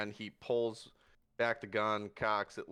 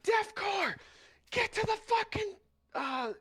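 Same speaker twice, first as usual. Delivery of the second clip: screaming soldier. Acted. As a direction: scream it across the chaos. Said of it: "you hear people scream out".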